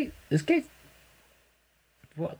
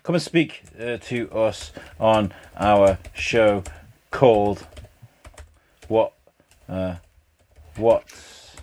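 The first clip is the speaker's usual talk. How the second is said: slow, halting, distracted delivery; dictating while typing; 3 semitones lower, slower than usual. Real, not acted. Host typing a message to someone, dictating haltingly. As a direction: say it piecemeal as you type each word. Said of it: "He is dictating what he is spe".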